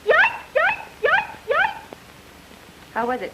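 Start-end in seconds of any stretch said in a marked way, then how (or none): 0.0-1.8 much higher, louder yapping little dog; dog barking. Pretend. Woman stalling a detective, imitating a yapping dog. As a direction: yap it out like a toy dog. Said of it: "even animals".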